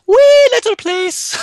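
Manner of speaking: very high, slow squeak mocking Earth's smallness; tiny squeaky voice. Mocking how small Earth looks, in a high squeak. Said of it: "It's like".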